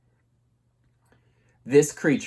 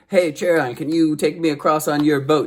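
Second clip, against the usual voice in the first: higher, louder hero asking for a ride; Orpheus asking; 5 semitones higher, 5 dB louder than usual. Retold or imitated. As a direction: call out to the ferryman, a bit higher and louder. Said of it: "he's like".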